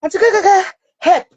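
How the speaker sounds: put-on high, loud child's call; imitated cry for help. Son pleading with his aunt while held down, imitated shouting. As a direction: shout for auntie to come to the rescue.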